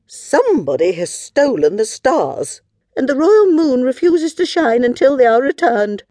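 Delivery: high, loud royal cry; Queen Moo. Acted. Queen announcing the stolen stars, loud and dramatic. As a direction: proclaim it in an outraged queenly voice. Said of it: "cried Queen Moo".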